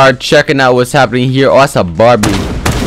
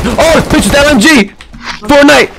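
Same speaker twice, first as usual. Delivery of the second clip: much higher, excited shouting over a teammate's kills; hyped cheering; 11 semitones higher than usual. Real, not acted. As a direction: cheer her on at the top of your voice.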